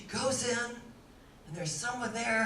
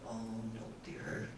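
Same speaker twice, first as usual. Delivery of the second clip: very low, soft refusal; dismayed old Contessa; 11 semitones lower, 11 dB quieter than usual. Retold or imitated. Contessa looking at the offer, low soft dismay. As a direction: play the Contessa, low and hushed.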